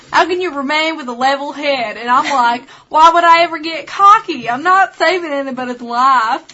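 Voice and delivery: hammed-up Southern twang, cracking up at times; Southern accent. Co-host reading an actress's quote in a competition, Southern accent. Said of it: "In the Southern accent. Let's do it".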